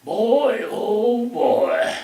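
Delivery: high, loud, put-on old man exclaiming; Oscar's voice. Oscar greets Bill from the wrecked Cadillac, high and loud put-on voice. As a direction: mimic the father-in-law's booming exclamation. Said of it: "that voice says".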